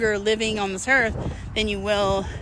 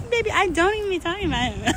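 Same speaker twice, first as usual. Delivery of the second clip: sassy to new man; 9 semitones higher than usual. Retold or imitated. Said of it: "I'm like".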